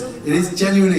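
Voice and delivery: ordinary talk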